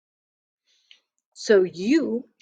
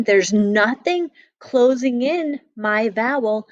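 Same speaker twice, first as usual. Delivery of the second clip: ordinary delivery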